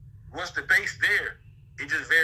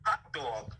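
"Hot dog" softer and lower with a New York aw; New York accent; 5 semitones lower, 6 dB quieter than usual. Shown as a demonstration. Asked to say it again, New York accent. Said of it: "Say it again".

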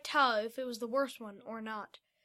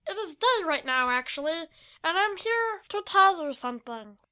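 high, louder creature voice; small creature's raised voice; high-pitched little creature; 5 semitones higher, 6 dB louder than usual. A narrator's reading. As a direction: chirp it like a tiny creature. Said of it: "said in a high-pitched voice".

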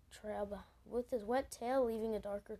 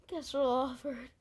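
plain reading voice

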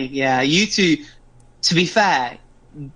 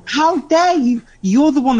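mock outrage; 11 semitones higher, 3 dB louder than usual.

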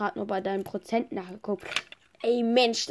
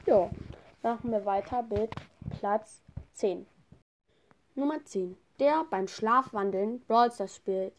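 ordinary talk, nothing marked

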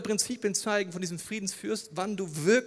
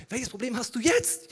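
insistent questioning; 7 semitones higher, 4 dB louder than usual. Retold or imitated. Friend presses the question once more.

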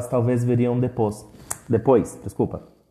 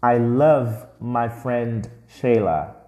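slow model sentence; slower than usual. Shown as a demonstration. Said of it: "Repete comigo".

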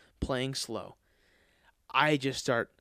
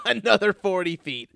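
high laugh breaking through words; loud rueful laughter; laughing at himself; 5 semitones higher, 8 dB louder than usual. Real, not acted. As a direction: laugh at your own disaster. Laughing at own bad shot.

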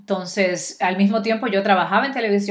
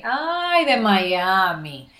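mocking reaction; much slower than usual. People in Mexico reacting to her Miami origin, mocking. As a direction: stretch it out in mock disdain.

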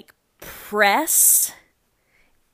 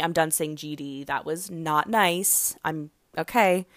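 normal talking voice

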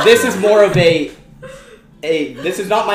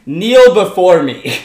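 slow, loud godlike command; booming demon lord; 5 dB louder, much slower than usual. Acted. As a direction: order them to kneel. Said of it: "I go".

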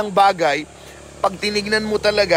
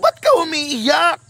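asked higher and slow, a caring question; questioning in Tagalog; 6 semitones higher than usual. Acted. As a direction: lift it high, like a parent asking. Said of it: "Sa Tagalog".

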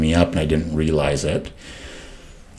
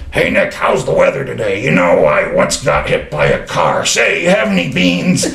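imitating Mark; 3 semitones higher, 6 dB louder than usual. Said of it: "he had this rough voice".

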